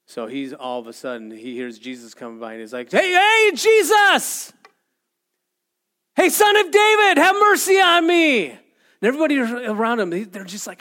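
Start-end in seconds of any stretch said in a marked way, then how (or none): 2.9-8.5 high, very loud cry for attention; acted desperate shout. Blind man calling to Jesus as he passes by, shouting. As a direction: holler it out to be heard over a crowd. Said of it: "He just shouts more".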